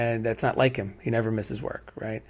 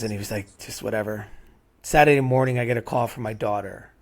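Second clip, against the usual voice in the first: normal talking voice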